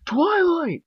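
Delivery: very high, loud little dragon calling; high loud frantic call; urgent calling. Acted. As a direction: shake her awake, yelling her name. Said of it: "Spike called".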